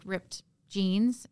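usual speaking voice

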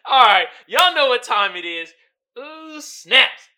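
loud, pumped call to the audience; hyped segment intro. Real, not acted. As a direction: fire up the crowd for meme time. Hyping the meme segment.

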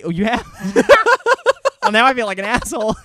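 real sheepish laughter after putting his foot in it; embarrassed laughing. Real, not acted. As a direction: caught out, cracking up mid-sentence. Embarrassed after friend admits it.

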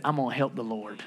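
low, soft aside; mock private resolve. Abraham decides God needs help, low mock aside. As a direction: drop it down as if thinking aloud.

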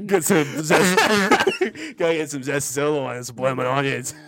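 put-on laughing through a clumsy Australian accent; bad Australian accent. Host doing the Outback order in a bad Australian accent, laughing. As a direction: hams it up and breaks into laughs. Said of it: "in an Australian accent because I'm very bad at accents".

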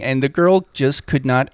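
ordinary talk, nothing marked

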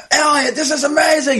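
high, shouted cheer; positive yelling. Retold or imitated. Parent cheering son during fencing bout, high positive shout. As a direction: cheer him on from the stands. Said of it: "I start yelling in a positive way".

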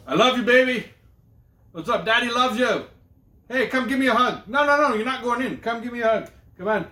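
loud, high, pestering call; embarrassing dad shout. Acted. Dad at school demanding a hug, shouting. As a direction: holler after the kid without letting up. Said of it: "I'm going down to her school".

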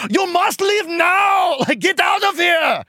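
high, drawn-out mock yell; hammed-up heroic shout, stretched out slow. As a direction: yell it high, ordering her to go. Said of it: "He yells at Kitty when she won't leave".

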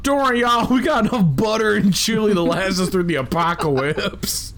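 high-pitched comic reassurance; mock folksy host. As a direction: put on a high, silly y'all voice. Joking as the bomb shelter's host.

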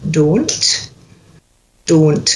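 "Don't" with a pure long O; Scottish "Don't", modelled for a learner; Scottish O sound. Shown as a demonstration. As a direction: say "Don't" with one unglided O, each evenly. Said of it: "we need to get that O sound".

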